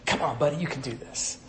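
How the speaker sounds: soft, low-key nudge to a buddy; fatherly encouragement. Acted. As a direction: drop to a quiet, coaxing murmur. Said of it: "giving that good fatherly".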